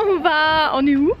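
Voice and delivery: ordinary talk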